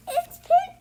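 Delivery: high, loud crying wail; panicked cry. Acted. Pip cries out high and loud when the crab pinches. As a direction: wail for help as the crab grabs you.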